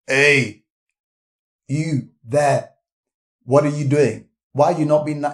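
loud, stern calling-out; mock scolding. Preacher acting out a Jesus who scolds us, mock stern.

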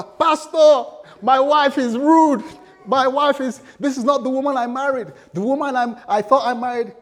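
high, very loud complaint to the pastor; outraged husband shouting. Acted. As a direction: wail it out at full volume, hammed-up. Husband complaining to pastor about wife.

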